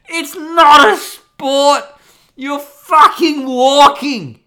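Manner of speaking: high, loud, slow exasperated shouting; outraged rant. Real, not acted. Ranting at the Wikipedia article, slow loud outrage. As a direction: yell it like the idea insults you.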